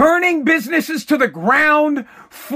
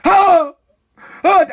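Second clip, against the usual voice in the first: mock panicked oh, higher and louder; 6 semitones higher, 5 dB louder than usual. Vlogger mocking Democrats freaking out, mock panicked oh. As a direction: fake a flustered gasp. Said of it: "they're freaking out".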